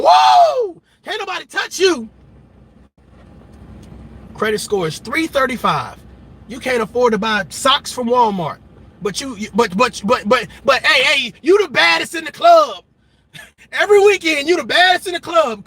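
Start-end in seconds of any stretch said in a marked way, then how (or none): 0.0-2.1 much higher, louder whoa; mock hype yell. Imitating hype creators pumping up a fan, mock yell. 10.9-15.7 higher, louder party shout; hammed-up club hype; mock hype man. Imitating a hype man flattering a broke fan, louder.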